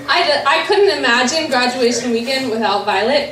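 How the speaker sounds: ordinary delivery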